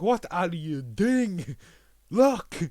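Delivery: mock exasperated yell; slow exasperated shout building to a yell. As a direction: drag out the plea then bark the last word. Watcher yelling at Hawkeye.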